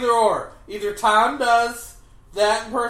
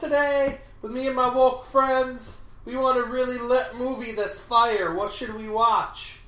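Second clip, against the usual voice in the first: put-on teen slang voice; 5 semitones higher, 3 dB louder than usual. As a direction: play a hyped-up teenager. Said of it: "the kids are like".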